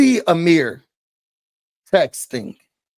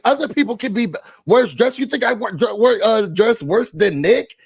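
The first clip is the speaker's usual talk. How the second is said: higher-pitched imitation of an indignant comeback; coming back too hard; 5 semitones higher than usual. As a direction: act out her overdone, stumbling retort. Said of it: "she came back too hard".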